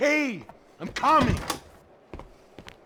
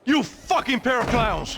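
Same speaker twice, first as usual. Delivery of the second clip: slow, seething, higher and angry; furious insult; 10 semitones higher, slower than usual. Acted. Boss yelling at the two bodyguards who failed, furious. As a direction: spit the insult at the guards.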